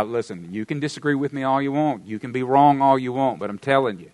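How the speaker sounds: ordinary talk, nothing marked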